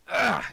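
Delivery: high, loud groan of effort; old man's groan. Acted. Old man groaning as he gets up to go to bed. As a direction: heave yourself up with a big old-man groan. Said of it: "the old man".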